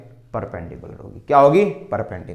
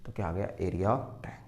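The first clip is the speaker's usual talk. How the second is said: whispered answer; hushed conclusion; 6 semitones lower, 11 dB quieter than usual. Shown as a demonstration.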